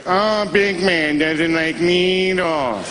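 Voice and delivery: slow, teasing taunt; mocking nurse. Nurse mocking the needle-shy patient, slow and teasing. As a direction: drag it out to tease him. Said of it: "She goes".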